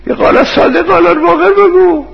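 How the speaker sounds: very high, straining yell of a heckler; full-voiced, high-pitched imitated outburst; retold shout. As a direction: yell it out in protest, imitating an angry listener. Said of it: "داد زد".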